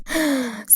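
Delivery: quick soft intake of breath; soft gasp of enthusiasm; excited gasp. Acted. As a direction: gasp at a sudden bright idea. Heroine's eyes light up.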